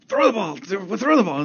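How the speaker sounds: high, loud mock shout, insisting; impatient fan yelling. Fan in the stands demanding a pass, high mock shout. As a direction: yell it again like a frustrated fan.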